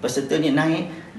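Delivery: ordinary talk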